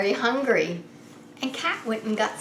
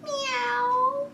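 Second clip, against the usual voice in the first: high, thin kitten mew with a rise; kitten meow. Acted. Reading to children, the kitten meows at Cat. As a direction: mew like a little lost kitten. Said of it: "said Kitten".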